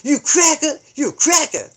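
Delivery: loud, high playground taunt; taunting kids. Retold or imitated. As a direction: jeer it like a little kid. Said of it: "little kids are like".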